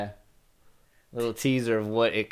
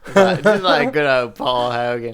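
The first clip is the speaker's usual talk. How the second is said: louder, hammed-up Australian; exaggerated Australian accent; 6 dB louder than usual. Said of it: "very offensive Australian accent".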